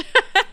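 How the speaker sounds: short high laugh. Real, not acted. Streamer breaking off from apology to Tosh, laughing. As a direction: break into a brief high giggle.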